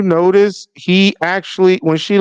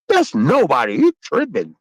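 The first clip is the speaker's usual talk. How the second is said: ordinary delivery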